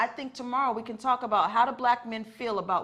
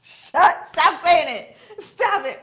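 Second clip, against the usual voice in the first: laughing protest; 8 semitones higher, 11 dB louder, much slower than usual. Real, not acted. Laughing, begging him to stop.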